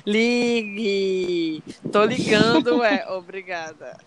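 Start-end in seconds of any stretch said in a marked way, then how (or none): none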